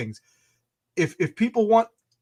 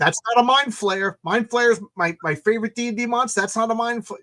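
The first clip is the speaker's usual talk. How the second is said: high, insistent fan objection; retold geeky outrage; indignant protest; 6 semitones higher than usual. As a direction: protest higher, as an affronted monster purist. Acting out his protest to wife.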